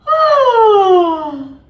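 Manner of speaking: high, loud phonated yawn sliding down; yawning sigh. Shown as a demonstration. Coach demonstrating a voiced yawn, sliding sigh. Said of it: "So yawn".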